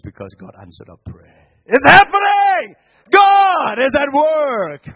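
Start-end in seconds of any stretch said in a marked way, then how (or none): 1.7-4.9 high, loud, slow proclamation; joyful shout. Real, not acted. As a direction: shout it out to the congregation. Preacher exclaiming God's work.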